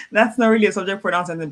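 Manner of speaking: ordinary talk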